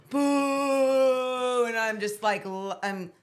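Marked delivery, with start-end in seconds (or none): 0.1-1.6 crowd booing. Retold or imitated. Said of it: "everyone's like".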